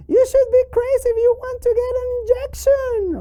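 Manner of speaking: fast, very high squeaky puppet voice; piping, hurried cartoon protest; Charlie. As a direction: rattle it off as an alarmed puppet. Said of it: "I know it's scary, Charlie".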